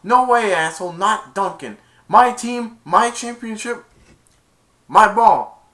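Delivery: loud, high territorial refusal; defiant yell. Retold or imitated. Player refusing to give up the ball, defiant yell. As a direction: claim it forcefully, punching each phrase.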